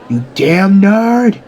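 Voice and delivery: loud, high, stretched-out fury; enraged yell. Acted. Bakugo charges towards Deku, enraged yell. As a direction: draw out the insult in anger.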